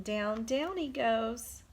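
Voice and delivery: ordinary delivery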